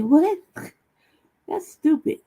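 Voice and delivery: high, outraged disbelief; livid retort. Retold or imitated. Recalling the rally speech, livid, high incredulous retort. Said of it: "I was, I was livid".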